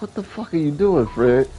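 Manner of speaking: retelling a stunned question to Fred; incredulous. Retold or imitated. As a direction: ask it like you can't believe him. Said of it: "I was like".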